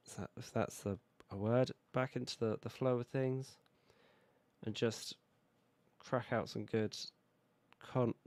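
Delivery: normal talking voice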